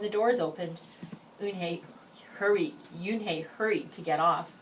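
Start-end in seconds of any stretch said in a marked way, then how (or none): none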